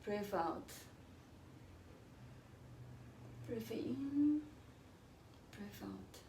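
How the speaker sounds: hushed, calm breath instructions; soft breathing cues. Acted. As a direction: guide the breath softly.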